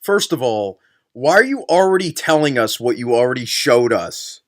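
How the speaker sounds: normal talking voice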